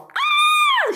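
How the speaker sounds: very high piercing scream. Acted. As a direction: shriek while tipping over onto the roof. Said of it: "she screamed".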